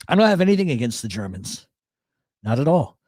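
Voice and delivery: ordinary talk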